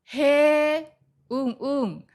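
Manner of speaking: interested, drawn-out backchannel reactions; reacting listener. Shown as a demonstration. Listener reacting while hearing someone's story, engaged. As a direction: show you are following with eager listener noises. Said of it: "リアクションをたくさんする".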